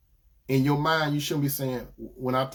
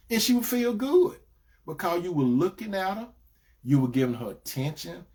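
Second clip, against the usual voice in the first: ordinary talk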